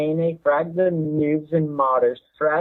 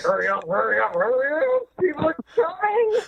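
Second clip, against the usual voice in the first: rising higher into a whiny panicked plea; mock panic; 10 semitones higher than usual. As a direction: urge them on in mounting fake panic, pitched up. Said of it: "you're like".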